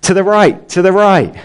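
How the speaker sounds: acted urgent call, pitched well up. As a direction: call a warning to a child veering off. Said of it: "And the dad calls out".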